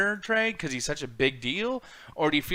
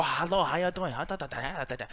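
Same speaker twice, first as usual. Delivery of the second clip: lightly voiced mock accent; mock Japanese accent; 6 dB quieter than usual. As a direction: play a cartoonish caricature. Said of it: "Go out there and go".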